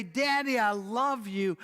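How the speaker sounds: put-on excited little girl. Four-year-old greets her father.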